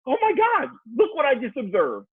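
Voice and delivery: high, eager exclamation; retold excited discovery. Husband recounting telling his wife what he noticed, excited. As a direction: act out sharing a thrilling find, pitch up.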